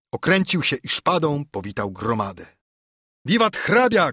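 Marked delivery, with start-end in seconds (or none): 3.3-4.1 high, raised cheer; narrated crowd cry; shouted cheer. A narrator's reading. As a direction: hail someone as a cheering crowd. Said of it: "krzyknęli".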